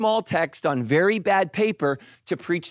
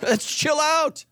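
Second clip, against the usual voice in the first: high, slow mock plea; hammed-up begging voice; mock pleading; 10 semitones higher, slower than usual. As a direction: plead with the car to calm down. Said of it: "I'm like".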